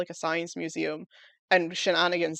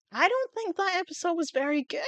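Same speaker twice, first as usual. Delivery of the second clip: high-pitched, hesitant mock confession; reluctant squeak; 11 semitones higher than usual. Admitting doubt about a favourite show, high squeak. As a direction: squeak out an unwelcome verdict. Retells own reluctant verdict on episode.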